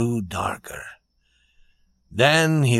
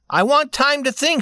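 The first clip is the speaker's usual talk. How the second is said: high-pitched quoted lady; young lady, firm; 4 dB louder than usual. A narrator's reading. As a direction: give her ultimatum in a raised pitch.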